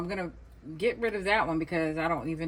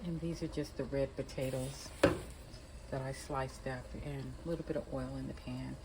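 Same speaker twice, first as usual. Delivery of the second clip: whispering; 2 semitones lower, 8 dB quieter than usual. Real, not acted. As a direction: keep it down to a whisper. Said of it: "I'm whispering because the baby's asleep".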